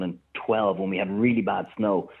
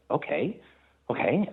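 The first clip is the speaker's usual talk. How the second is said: stunned high okay; 7 semitones higher, slower than usual. Retold or imitated. Taken aback by the hooker reply.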